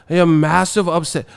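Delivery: high put-on British accent; hammed-up British outburst; mock British fan. British fan reacting to the result, mock accent. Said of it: "the British teeth be like".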